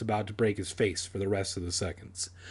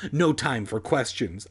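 ordinary delivery

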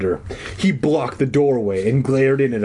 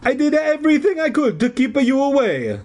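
high-pitched hammed-up Italian; Italian accent; 14 semitones higher than usual. A narrator's reading. Phantom explaining himself to the kids, Italian accent. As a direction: plead your case in stage-Italian. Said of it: "his hoarse Italian voice".

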